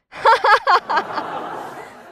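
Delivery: high, loud run of mad laughter; crazed laugh, acted. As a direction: laugh hysterically like someone losing her mind. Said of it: "Me reía".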